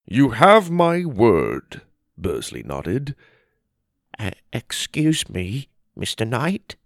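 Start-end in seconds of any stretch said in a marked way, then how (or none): 0.1-1.8 slow, higher, weighty promise; solemn pledge. A narrator's reading. Bursley promises Theodore to equip the family, solemn pledge. Bursley vows to his leader. 4.2-6.8 shaky voice. A narrator's reading. A dandy fairy humbly interrupts the council, shaky voice. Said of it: "came a shaky voice".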